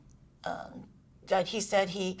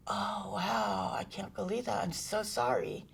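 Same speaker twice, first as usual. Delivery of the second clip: softer, hollow fake apology; mock-sorry husband; 6 dB quieter than usual. Imitating the husband's fake apology, softer and mock-sorry. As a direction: imitate his half-hearted, mock-sincere sorry. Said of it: "And he was like".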